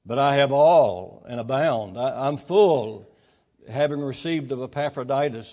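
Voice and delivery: slow, loud, booming preacher emphasis; preaching at full force. Acted. Pastor booming the verse to the congregation, slow and loud. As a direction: lean on every word of the verse.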